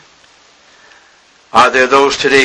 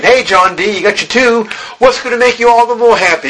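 mock eager questioner; 10 semitones higher than usual. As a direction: needle him like a curious crowd. Voicing people questioning Rockefeller.

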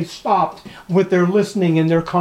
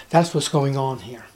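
ordinary talk, nothing marked

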